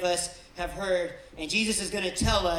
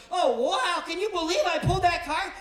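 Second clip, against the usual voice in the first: high, louder hammed-up gasp of wonder; mock delight; 11 semitones higher, 6 dB louder than usual. Mocking a tarot puller to the church, hammed-up high thrill. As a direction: play the thrilled card-puller, overdone.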